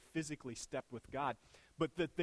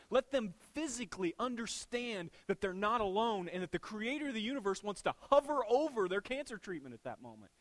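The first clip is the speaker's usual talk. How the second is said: ordinary talk, nothing marked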